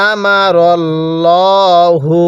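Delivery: মা drawn out three alifs in আমারাল্লাহু; Quranic recitation. Shown as a demonstration. Teacher showing the long vowel, slow recitation. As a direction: hold the মা long, recite slowly. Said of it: "মায়ের মধ্যে তিন আলিফ".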